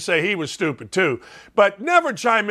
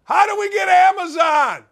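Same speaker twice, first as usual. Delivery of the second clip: high, helpless holler; yelling through house; 10 semitones higher than usual. Acted. Husband asking where the game is, yelling through house. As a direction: shout the question across the house, acted out. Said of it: "Here's what you're gonna hear in my house".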